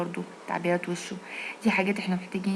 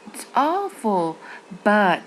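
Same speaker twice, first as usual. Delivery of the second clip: awful slow and raised, but loud; right intonation; 4 dB louder, much slower than usual. Shown as a demonstration. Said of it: "ده برضو intonation". (النغمة الصح مع but عالية)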